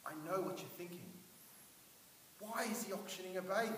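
normal talking voice

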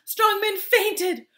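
high, loud, stretched-out wail; alarmed narration. A narrator's reading. Storyteller as strong men faint, alarmed wail.

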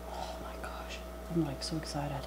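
breathless hushed whisper; excited whisper. Real, not acted. Vlogger whispering in awe at her sandwich. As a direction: whisper your excitement over the food. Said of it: "it just makes me whisper".

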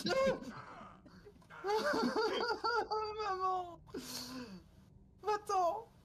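high scream then a nervous laugh; terrified scream. Real, not acted. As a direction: shriek in fright, calling for mum. Monster jumps out.

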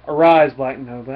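loud mock-heroic summons; commanding proclamation. Alien warrior summons Nova to answer, commanding proclamation. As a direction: proclaim it like a comic book warrior.

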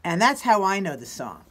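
ordinary delivery

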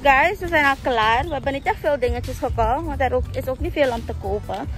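normal talking voice

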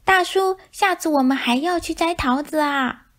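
high call across a distance. Acted. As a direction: wave and call after him. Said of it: "小老鼠一边挥手一边喊". (小老鼠挥手大声喊)

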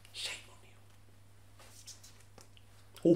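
hushed scolding whisper. Acted. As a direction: whisper the shame at them. Scolding staff dramatically.

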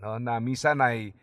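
usual speaking voice

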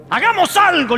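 eager self-quote. Retold or imitated. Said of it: "le dije".

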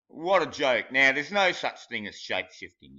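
ordinary talk

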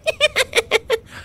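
very high little chuckle; squeaky amused giggle; griffin chuckle. Acted. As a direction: chuckle at the silly guess. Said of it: "Gabby chuckled".

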